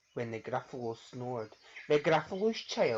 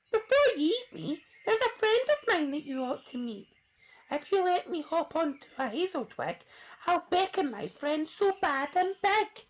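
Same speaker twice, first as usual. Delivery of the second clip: very high, louder mouse voice; clever mouse; 5 dB louder than usual. Acted. Mouse talking the child out of eating him, high. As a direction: bargain quickly.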